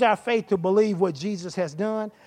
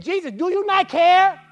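panicked plea; 7 semitones higher, 7 dB louder than usual. Acted. Disciples wake Jesus in storm.

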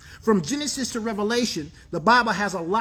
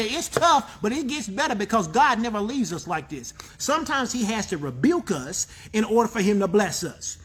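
ordinary talk, nothing marked

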